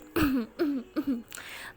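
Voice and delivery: aunty clears her throat to interrupt; pointed ahem to catch them in the act; pointed throat clearing. Acted. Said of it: "تو aunty کہتی ہے،".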